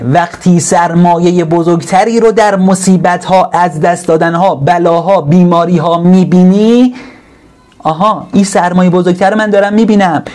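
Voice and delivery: impassioned sermon climax; fervent preaching. Acted. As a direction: preach it out with rising force. Preaching builds to emphasis.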